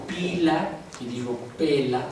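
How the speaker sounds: slow, over-articulated "pila" then "pela" with the mouth opening wider; exaggerated mouth opening. Shown as a demonstration. Teacher demonstrating vowels to the class, slow and exaggerated. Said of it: "bueno, estoy exagerando".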